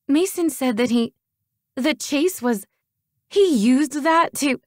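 high, loud, halting outrage; sputter, too angry to finish. A narrator's reading. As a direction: choke on the words in fury. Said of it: "I sputtered in outrage".

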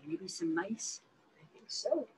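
soft unvoiced whisper; a hushed whisper as if for the little one. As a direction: whisper it like a secret. Whispered answer for the baby.

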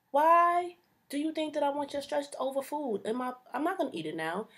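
indignant. Acted. Indignant rant at waitress.

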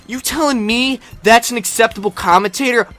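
ordinary talk, nothing marked

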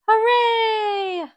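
high, loud joyful shout; cheering hooray. Acted. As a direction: cheer like a delighted child on landing. Plane lands, passengers cheer.

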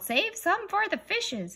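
high, slow group yell; kids yelling in chorus. Acted. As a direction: yell it like a whole line of impatient kids. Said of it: "until everybody yelled".

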